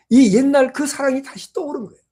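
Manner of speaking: ordinary talk, nothing marked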